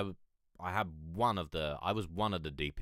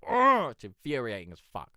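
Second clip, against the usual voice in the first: exasperated; 4 semitones higher, 10 dB louder than usual. Real, not acted. Annoyed about voice cracks.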